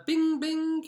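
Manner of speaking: high, loud imitated ping; mock alert chime. Shown as a demonstration. Host pinging in a new score, high imitated chime. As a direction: sing out a high little alert noise. Sound effect as scores come in.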